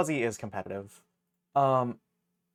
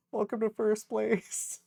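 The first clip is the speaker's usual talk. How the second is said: laughing; 9 semitones higher, 4 dB louder than usual. Real, not acted. Laughing welcome.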